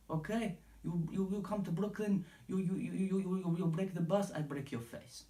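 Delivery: high-pitched mock Dagestani accent in broken English; Khabib impression. Podcaster mimicking Khabib's threat to Conor, hammed-up high Dagestani accent. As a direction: ham up a Dagestani fighter's accent, pitched well up. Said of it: "that's pretty much what Khabib was saying".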